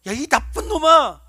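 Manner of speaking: loud pretend rebuke; scolding shout. Imagined Jesus scolding Peter, loud pretend shout.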